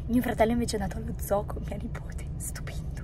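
hoarse whisper; voice giving out. Real, not acted. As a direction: voice almost gone. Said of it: "non ho più voce letteralmente".

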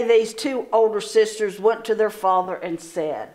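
usual speaking voice

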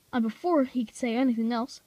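plain reading voice

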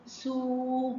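"سو" with the oo held out; sustained phonics "سو"; long vowel. Shown as a demonstration. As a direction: stretch the waw in "سو". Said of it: "لازم أطول الصوت بتاع السين".

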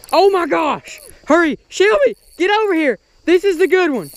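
yelling for help landing a huge fish; excited. Real, not acted. Shouting to Shelby to hurry over, thrilled. Hooking a giant bass, calling Shelby.

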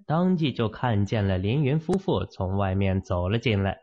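usual speaking voice

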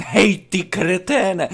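slow, high-pitched mock shout; acted yelling. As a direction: yell it like heckling someone through a window. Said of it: "začnu na vás řvát". (předváděný řev)

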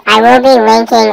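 usual speaking voice

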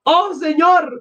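high, drawn-out cry of dismay; acted high-pitched outcry; alarmed shout. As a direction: cry out high over an injury. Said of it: "Gritó Sonia".

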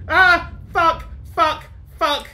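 loud, high, panicked cursing; startled yelling. Real, not acted. Player jumped by a game scare, startled yelling. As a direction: yelp out each word in shock.

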